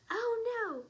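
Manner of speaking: very high, loud cry of dismay. A narrator's reading. Lily sees powder stuck on kangaroo, crying out in dismay. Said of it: "cried Lily".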